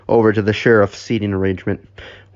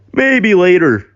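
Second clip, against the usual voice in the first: louder, high-pitched scared reply; petrified; 8 semitones higher, 5 dB louder than usual. Acted. Bozo putting off Dougie's request, petrified. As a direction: answer high and terrified. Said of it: "in a petrified voice".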